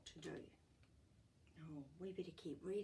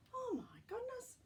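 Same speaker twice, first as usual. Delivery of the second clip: amazed. Real, not acted. Reader's amazed aside.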